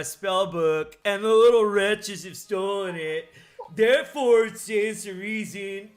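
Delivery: loud, put-on straight-bro voice; guy straight voice. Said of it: "I'm gonna do a guy straight voice".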